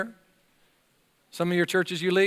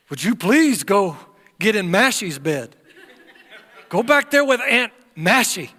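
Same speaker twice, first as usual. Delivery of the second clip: louder, laughing plea to the grandkids; coaxing grandparent; 3 semitones higher, 4 dB louder than usual. Retold or imitated. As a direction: beg them off to another bed, laughing.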